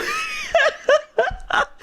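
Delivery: high, screechy burst of laughter; delighted squealing laugh; shrieking laugh. Real, not acted. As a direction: laugh until the voice cracks up high. Laughing at a funny line.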